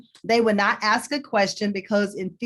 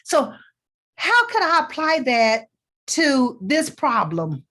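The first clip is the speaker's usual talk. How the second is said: higher, eager, leaning in; excited question; 4 semitones higher, slower than usual. Shown as a demonstration. Coach demonstrating a curious question for listeners, eager and excited. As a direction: ask like you can't wait to learn more. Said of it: "that's showing what? Excitement".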